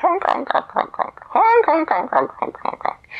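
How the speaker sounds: wild geese laughing at Nessie with honks. A narrator's reading. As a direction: honk like geese laughing at a newcomer. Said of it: "They laughed".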